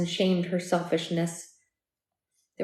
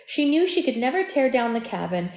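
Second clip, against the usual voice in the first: usual speaking voice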